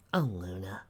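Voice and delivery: low, soft, breathed sympathy; tender. A narrator's reading. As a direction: comfort a younger sister softly. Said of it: "smiling softly".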